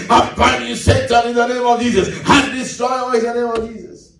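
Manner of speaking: hammed-up prayer-warrior binding; noisy prayer warrior. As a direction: imitate a showy prayer warrior, chanting on a high pitch. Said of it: "they make a lot of noise".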